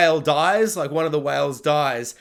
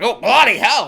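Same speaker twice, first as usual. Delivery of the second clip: louder, higher mock Australian outburst; imitated Australian exclamation; 4 semitones higher, 8 dB louder than usual. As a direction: burst out like the Australian film character. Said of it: "and he's like".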